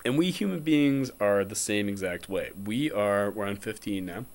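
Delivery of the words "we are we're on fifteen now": normal talking voice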